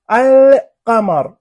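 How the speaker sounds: "القمر" slow and loud, L held long; lam pronounced. Shown as a demonstration. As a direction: split "القمر" as alll-qamar. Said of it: "عندنا ألف لام قاف". ("القمر" بلام ظاهرة واضحة)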